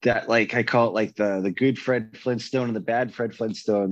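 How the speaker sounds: usual speaking voice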